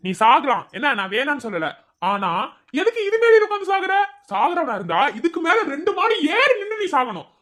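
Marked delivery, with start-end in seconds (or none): 2.8-6.8 higher, scolding disbelief; exasperated scolding. Acted. Scolding the friend perched on the ledge, exasperated. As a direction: scold him for sitting there to die.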